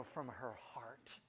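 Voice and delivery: hushed, slowed whisper; whispered aside. Acted. To the audience about his wife, a slow whisper. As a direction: drop to a whisper for the punchline.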